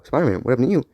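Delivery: usual speaking voice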